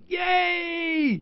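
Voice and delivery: high excited shout; cheerful yell. Acted. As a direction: yell it out with joy. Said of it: "let me hear you yell".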